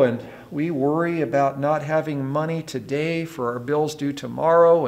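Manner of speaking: ordinary talk, nothing marked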